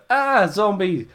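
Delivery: high-pitched pretend fright; half-hearted imitation of a scared scream; mock scream. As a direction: yelp like a comically spooked wrestler. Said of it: "he screams as Cameron Grimes runs away".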